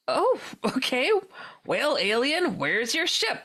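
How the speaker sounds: humoring the alien in mock acceptance; joking. Teller teasing the self-declared alien, joking. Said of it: "I'm still joking with".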